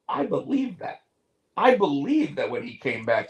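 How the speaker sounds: ordinary talk, nothing marked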